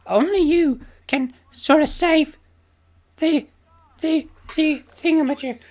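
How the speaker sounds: mock a dreamy anime princess; cartoon princess. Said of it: "a princess voice in the background being like".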